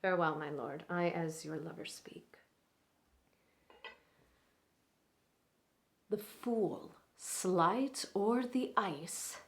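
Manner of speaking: measured Shakespearean monologue with long pauses; dramatic classical stage delivery; Ulysses. Acted. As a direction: play a strategist working on a proud lord, swelling then dropping to hushed asides. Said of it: "Ulysses in Troilus and Cressida by William Shakespeare".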